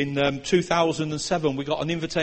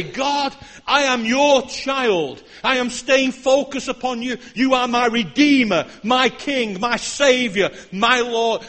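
fervent prayer declaration; 5 semitones higher, 5 dB louder than usual. Acted. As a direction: proclaim it to God with conviction. Preacher voices a declaring prayer.

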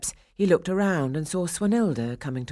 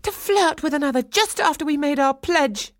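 high, loud, outraged voice; angry accusation; 12 semitones higher, 5 dB louder than usual. A narrator's reading. Swanhilda accusing Franz of flirting, loud and outraged. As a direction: hurl the accusation, pitched right up.

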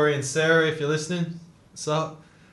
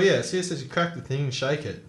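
ordinary delivery

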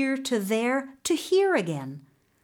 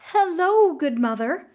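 high, loud, forced brightness; nervous greeting; 7 semitones higher, 5 dB louder than usual. Acted. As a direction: greet her, trying not to shake. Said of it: "trying not to shake".

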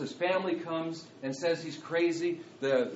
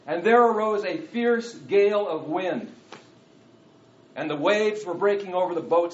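loud, high, ringing Bible reading; raised preacher's reading with big emphatic stresses; dramatic scripture reading; 3 semitones higher, 8 dB louder than usual. Acted. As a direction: proclaim the verses to the congregation. Reads scripture aloud to congregation.

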